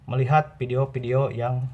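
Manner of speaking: normal talking voice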